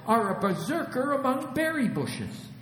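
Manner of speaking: very high bird voice; piping, high-pitched sparrow chatter; chirping sparrows. A narrator's reading. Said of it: "chirped the sparrows".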